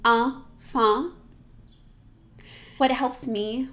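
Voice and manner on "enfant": enfant with nasal ah vowels, no n; French nasal enfant. Shown as a demonstration. Teacher modeling enfant for students, nasal French vowels. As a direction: sing enfant with pure dark nasal vowels. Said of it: "Say that with me".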